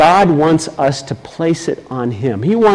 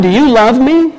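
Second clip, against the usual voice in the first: Jesus asking Peter; 9 semitones higher, 4 dB louder than usual. Acted. Jesus questions Peter again.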